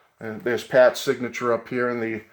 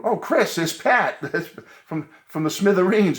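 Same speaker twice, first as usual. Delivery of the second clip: retold excited gasp; 8 semitones higher, 4 dB louder than usual. Excitedly spotting his musical hero.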